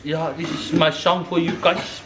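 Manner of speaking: ordinary delivery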